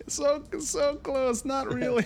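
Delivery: high-pitched, squeezed through laughter; giggly high voice; laughing. Real, not acted. Near miss on the dice, laughing.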